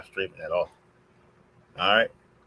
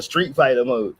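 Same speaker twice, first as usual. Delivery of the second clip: normal talking voice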